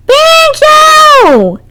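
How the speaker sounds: high, loud yell; yelling a name. Acted. Girls searching the neighborhood, yelling loud. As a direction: shout for the missing cat. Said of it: "continued yelling his name".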